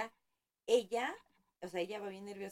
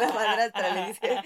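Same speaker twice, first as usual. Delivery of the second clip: laughing; 3 semitones higher, 14 dB louder than usual. Real, not acted. Laughing while retelling scene.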